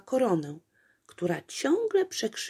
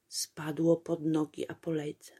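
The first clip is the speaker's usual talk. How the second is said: usual speaking voice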